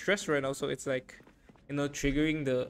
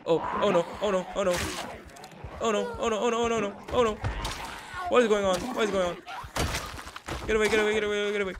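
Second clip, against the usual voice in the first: high, loud frantic repetition; alarmed yelling under attack; panicked; 6 semitones higher, 4 dB louder, much faster than usual. Real, not acted. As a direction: cry out as danger closes in. Swarmed by armed zombies.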